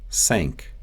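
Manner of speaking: "Thank" said as sank; Russian S for TH in "Thank"; Russian accent. Shown as a demonstration. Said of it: "The T H sound".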